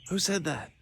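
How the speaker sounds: high, soft, startled question; startled boy. Acted. Hiding boy asks the unseen stranger, startled. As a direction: peek out nervously. Tad startled behind a signpost.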